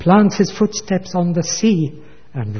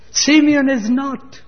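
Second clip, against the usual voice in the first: Simeon is not high and slow, stretched out; mocking echo; 10 semitones higher, 4 dB louder, slower than usual. Preacher repeating Jacob's mistaken words, high slow mock echo. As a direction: echo the old man's mistake with gentle irony.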